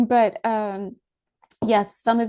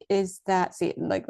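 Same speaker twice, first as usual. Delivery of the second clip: ordinary delivery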